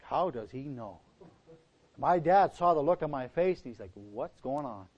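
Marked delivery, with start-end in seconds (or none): 0.0-1.0 low, soft aside to himself; hushed dismay. Retold or imitated. Guilty teen realizing his friend knows, hushed dismay. His reaction to being found out. 3.9-4.9 low, soft imitation of dad; puzzled dad. Dad sees the look on son's face, puzzled and low.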